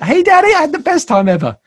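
imitating an excited child, high and quick; happy little daughter. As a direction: gush to Daddy in a high voice. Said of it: "She was so happy. She's like".

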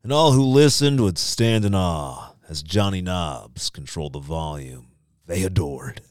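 poem reading. Acted. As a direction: read it like a dramatic poem. Reads the poem aloud.

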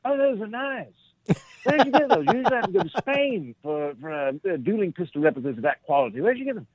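put-on high, bright-eyed British English accent, impressed; a polite armed Englishman admiring a find in a British accent; chipper British soldier. British soldier quoted admiring pistols.

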